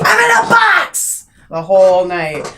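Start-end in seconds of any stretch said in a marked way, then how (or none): 0.0-1.2 high, loud yell of a trapped mime. Retold or imitated. Halloween mime yelling at party guests, loud yell. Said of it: "just yell at everybody".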